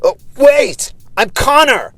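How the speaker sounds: high shouted call; shouting. A narrator's reading. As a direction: call out to stop him. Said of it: "but the man shouted".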